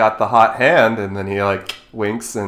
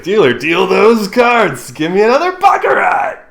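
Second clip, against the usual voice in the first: eager gambler; 10 semitones higher, 6 dB louder than usual. Acted. Gambler calling to the dealer, eager and loud. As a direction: rub your hands and demand the cards. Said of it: "earnestly rubs his hands together and says".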